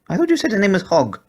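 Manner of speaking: very high questioning pug voice; a pug hearing a strange name; high pug voice, puzzled; puzzled squeaky pug. A narrator's reading. Porky's voice.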